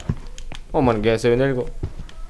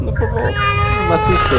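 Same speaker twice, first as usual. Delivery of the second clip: very high, tearful plea; scared, crying-like begging; frightened whimper; 4 dB louder than usual. Real, not acted. Begging the game not to scare.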